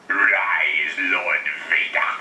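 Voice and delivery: low rasping Emperor voice; a hint of a sinister old Sidious; cold rasping evil emperor.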